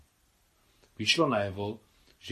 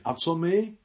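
high, loud protesting cry; exclaimed question; 9 semitones higher, 5 dB louder than usual. A narrator's reading. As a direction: cry it out as an urgent appeal. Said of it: "vykřikla Claudine". (naléhavě vykřiknutá otázka)

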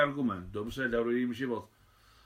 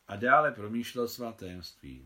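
usual speaking voice

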